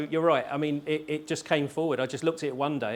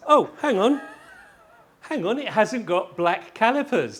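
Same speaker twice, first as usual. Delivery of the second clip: mock surprise; 9 semitones higher, 8 dB louder than usual. Mock alarm at the calipers.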